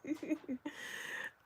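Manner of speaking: high embarrassed giggle; short giggle at yourself. Real, not acted. Laughing at own hiccups.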